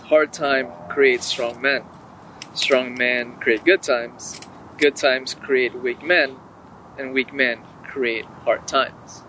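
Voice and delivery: slow, deliberate quote recital; solemn recitation. Shown as a demonstration. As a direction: recite it slowly like wisdom.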